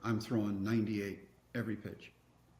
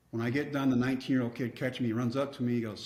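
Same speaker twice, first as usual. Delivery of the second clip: usual speaking voice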